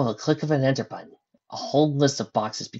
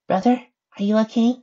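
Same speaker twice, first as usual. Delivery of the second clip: Adam's higher voice; 11 semitones higher, 6 dB louder than usual. Acted.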